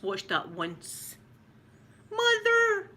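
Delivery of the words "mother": high, loud fawn's cry for its mother; Bambi calling mother. Retold or imitated. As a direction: call out like a lost fawn. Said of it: "like that".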